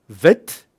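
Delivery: vit with a clipped short vowel. Shown as a demonstration. Teacher demonstrating the short Canadian vowel in vit. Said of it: "which is short".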